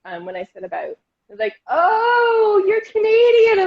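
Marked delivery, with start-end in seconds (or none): 1.7-3.7 high, loud, drawn-out mock cry; mock exclamation. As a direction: imitate an over-the-top delighted reaction. Others react to her Canadian slip.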